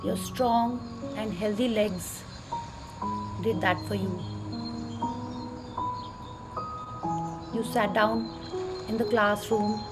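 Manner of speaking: soft, slow meditation voice; calmly walk them through gratitude; guided meditation. Acted.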